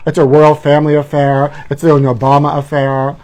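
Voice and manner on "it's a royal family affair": usual speaking voice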